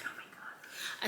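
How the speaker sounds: hushed mock exasperation; whispered aside. Woman reacting to being begged, whispered exasperation. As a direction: whisper it under your breath.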